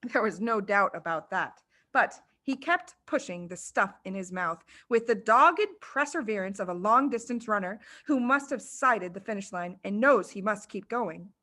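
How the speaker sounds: usual speaking voice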